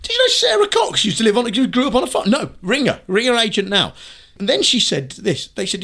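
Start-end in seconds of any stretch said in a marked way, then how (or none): 0.0-3.9 high, eager producer voice; excited producer. Retold or imitated. Acting out TV meeting.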